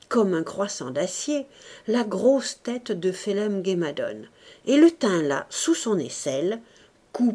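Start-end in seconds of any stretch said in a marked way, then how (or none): none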